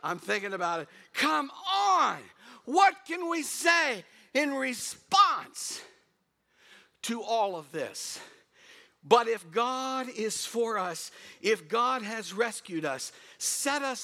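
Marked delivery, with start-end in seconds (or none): 1.1-8.2 higher, louder, slow exhortation; rallying call. Real, not acted. Preacher rallying the congregation, fired up. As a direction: rouse the room.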